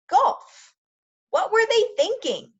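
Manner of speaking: high, loud disbelief at golf; mock-outraged who would pick golf; incredulous. Incredulous outburst at dropping wrestling.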